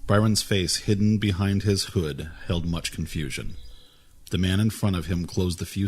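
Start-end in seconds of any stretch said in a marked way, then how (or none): none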